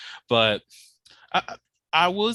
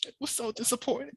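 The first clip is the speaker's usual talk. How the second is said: mock lament; 11 semitones higher, 8 dB quieter, faster than usual. Mock wail over shrimp.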